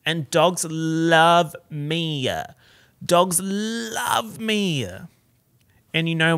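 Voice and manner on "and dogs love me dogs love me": drawn-out boast. Real, not acted. Drawn-out sing-song boast.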